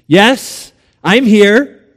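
projected, assured reply; calling down. Acted. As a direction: call down to someone below. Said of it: "a voice called down".

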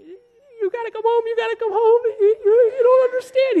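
high, loud wailing imitation of sobbing; hammed-up sob through a frantic phone call; mock sobbing. Professor's wife phones him in tears, mock sobbing. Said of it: "She's, like, sobbing".

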